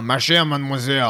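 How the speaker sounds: throaty mock-French Lumière; Jerry Orbach. Actor quoting Lumière to show a fake French accent, throaty impression. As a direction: say it from the back of the throat. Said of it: "ça vient du fond de la gorge".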